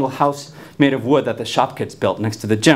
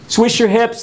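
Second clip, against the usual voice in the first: taunting call; 7 semitones higher, slower than usual. Retold or imitated. Friends call out abuse.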